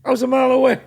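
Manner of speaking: high, loud insistent whine; a suspect in a lineup pleading his alibi. Retold or imitated. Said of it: "He goes".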